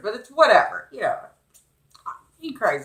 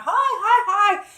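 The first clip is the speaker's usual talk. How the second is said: high, louder, bouncing little hi's; excited kid; 13 semitones higher, 5 dB louder than usual. Retold or imitated. The boy greeting the bird, high and excited. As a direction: chirp it like a jumping child. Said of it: "He's jumping around".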